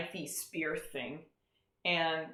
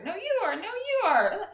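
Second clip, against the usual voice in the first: put-on bickering kids; 13 semitones higher, 5 dB louder than usual. Kids accusing each other.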